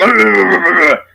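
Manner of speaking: loud put-on wordless vocal noise; example voice noise. Shown as a demonstration. Musician showing listeners the voice is not anger, loud wordless outburst. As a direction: let out a loud demo growl. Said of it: "give you an example, like".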